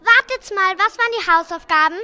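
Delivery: put-on high, hurried kid voice; excited kid. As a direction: blurt it out fast. Acted schoolkid asking.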